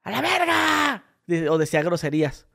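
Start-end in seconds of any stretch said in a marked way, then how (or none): 0.0-1.0 grito de desahogo. Retold or imitated. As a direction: stretch it into a high-pitched reenacted shout. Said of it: "puedo gritar". (grito agudo y alargado, imitado)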